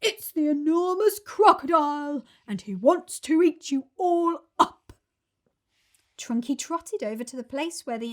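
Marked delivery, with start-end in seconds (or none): 0.0-4.9 loud booming elephant voice; bellowed. Acted. Trunky bellows at the children, loud booming. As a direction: bellow it across the field. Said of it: "he bellowed".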